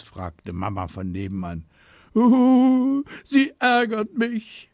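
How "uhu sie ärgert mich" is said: high, loud mock bawling; hammed-up boohoo; wailing complaint. A narrator's reading. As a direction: wail it to mum like a tattling child. Boy wails to mother, tattling.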